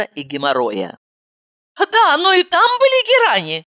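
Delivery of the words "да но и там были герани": high, eager voice; perked up. Acted. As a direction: brighten up eagerly. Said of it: "оживилась я".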